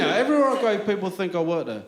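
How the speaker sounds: ordinary delivery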